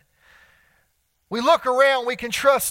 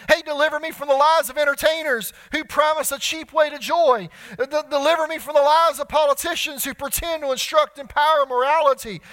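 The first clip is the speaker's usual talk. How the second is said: preaching at full force; 4 semitones higher than usual. Acted. Preacher crying out to God in a deliverance litany, preaching. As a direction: preach each plea harder, building.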